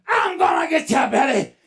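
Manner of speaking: high monster shout; menacing bushman. Acted. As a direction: lunge at your prey with the words. Monster lunging in the chase.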